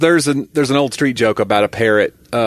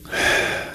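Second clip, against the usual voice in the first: acted weary sigh; exasperated sigh; 5 dB quieter than usual. Woman fed up with the parrot, exasperated sigh. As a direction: heave a put-upon sigh. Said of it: "She goes".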